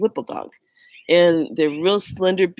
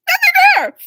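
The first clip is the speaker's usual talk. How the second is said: high, louder cry of nothing there; shocked disbelief; 7 dB louder, faster than usual. Retold or imitated. Checking the kitchen again with her sister, a high stunned cry. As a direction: pitch leaps up, loud and stunned. Searching kitchen, dog gone.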